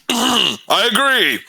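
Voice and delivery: high, slow unearthly echo. Acted. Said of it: "You hear an echoing voice coming from down the tunnel".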